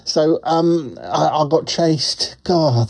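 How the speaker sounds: ordinary talk, nothing marked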